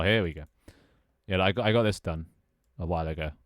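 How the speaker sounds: usual speaking voice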